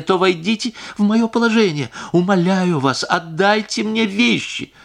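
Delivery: high, imploring plea; desperate pleading. A narrator's reading. Wife begs the singer.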